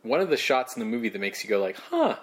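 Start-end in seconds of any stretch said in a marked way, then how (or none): 1.8-2.2 much higher, pleasantly surprised praise; a startled, impressed "huh, good job"; surprised approval. Real, not acted. Podcaster grudgingly praises Ed Wood's shot, high surprised approval.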